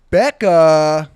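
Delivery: loud, showy guest announcement; announcer voice. Real, not acted. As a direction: call the name out like a host.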